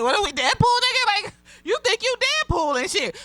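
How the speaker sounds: high, fast mocking taunt; mocking quote. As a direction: toss it off high and quick, teasing. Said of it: "It's like".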